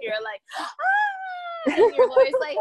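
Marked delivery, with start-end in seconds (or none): 0.6-1.7 high, hammed-up scream of a panicked client; mock scream. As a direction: shriek like a frantic client calling their lawyer. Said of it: "you both are, like, screaming".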